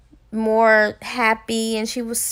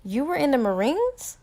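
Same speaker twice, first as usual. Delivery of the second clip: mildly shocked; 4 semitones higher, 5 dB quieter than usual. Acted. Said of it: "kinda shocked".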